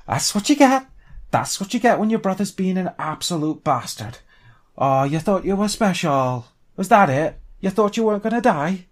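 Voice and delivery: loud, high-pitched jeering; gloating taunt. Acted. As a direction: crow over a beaten enemy, loud and high. Taunts dead orc while kicking it.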